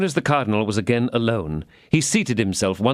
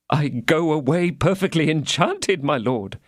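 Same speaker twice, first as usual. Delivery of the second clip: higher, pleading little mercer voice; fawning mercer; 6 semitones higher than usual. A narrator's reading. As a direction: grovel to the cardinal in a thin voice. Bonacieux voiced.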